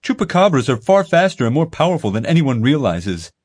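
hammed-up insistent boy; conspiracy kid. A narrator's reading. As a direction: sell the secret like it's gospel. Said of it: "Ethan told him".

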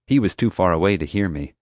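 plain reading voice

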